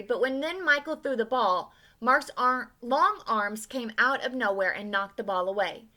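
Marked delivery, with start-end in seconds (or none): none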